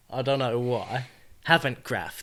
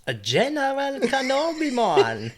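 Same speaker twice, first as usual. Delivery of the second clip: lightly Jamaican General Kenobi, much higher; mock Jamaican accent; 11 semitones higher, 3 dB louder than usual. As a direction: toss off the line in a loose Jamaican lilt. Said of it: "I'm talking Jamaican now".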